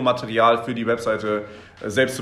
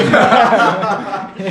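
high loud burst of laughter; 9 semitones higher, 9 dB louder than usual. Real, not acted. Laughing at beach towel joke.

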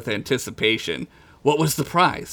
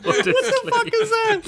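high, laughing outburst; laughing disbelief. Real, not acted. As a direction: squeal it through laughter.

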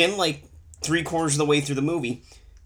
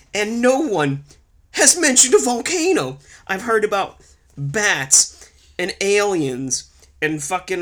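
exasperated rant; 8 semitones higher, 4 dB louder than usual. Real, not acted. Exasperated rant about the volcano.

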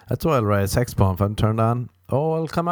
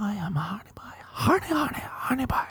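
high, eerie whisper; a creepy inner whisper chanting; brain whispering; 12 semitones higher, 6 dB quieter than usual. Pretend. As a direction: whisper it like a voice in your head. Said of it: "It's my brain says to me".

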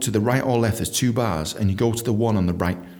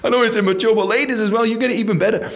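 laughing excitedly; 9 semitones higher, 4 dB louder than usual. Real, not acted. Giddy laughing rant about toilets.

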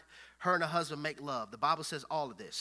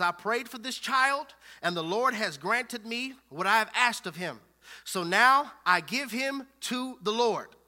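loud, higher, declaimed scripture reading; scripture preaching; 6 semitones higher, 11 dB louder than usual. Real, not acted. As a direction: proclaim the verse forcefully, leaning on key words.

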